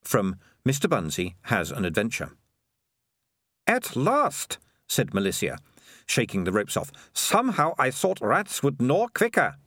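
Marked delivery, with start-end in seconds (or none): 3.7-4.6 much higher character voice; impatient and high-pitched; Melissa, impatient girl. A narrator's reading. Melissa being freed from the ropes, impatient complaint. 7.1-9.6 higher voice, grumbling; Melissa, impatient girl. A narrator's reading. Melissa shaking the ropes off, impatient grumble.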